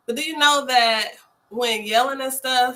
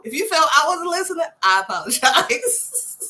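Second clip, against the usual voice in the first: laughing fake apology; 5 semitones higher, 3 dB louder than usual. To her friend, a laughing half-hearted apology. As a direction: say sorry while laughing it off. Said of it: "No, you didn't".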